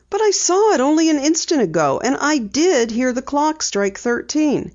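put-on light girl voice for Penny; Penny, lightly voiced. A narrator's reading. Penny telling her dad she'll drive, lightly voiced girl.

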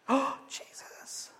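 slow, breathy-free whispered swoon. Acted. Swooning crowd whispers to Jesus in admiration. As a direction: sigh it out in adoration.

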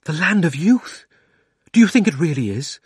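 put-on a boy clutching at a hope; eager cry. A narrator's reading. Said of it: "cried Diggory".

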